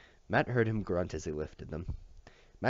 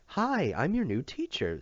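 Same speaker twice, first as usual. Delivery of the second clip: high, slow, sugary-bright teacher greeting; hearty teacher voice; 8 semitones higher, slower than usual. Acted. New teacher greeting the boy with high hearty cheer. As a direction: greet him like a too-cheery schoolteacher. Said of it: "in a hearty voice".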